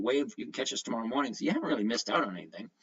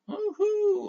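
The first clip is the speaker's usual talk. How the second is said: excited cheer; 4 dB louder than usual. Real, not acted. Sound finally fixed, celebrating.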